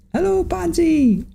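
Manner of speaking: playful high-pitched cartoon voice; silly high greeting. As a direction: greet Bonzi in a squeaky put-on voice. Host greets viewer in silly voice.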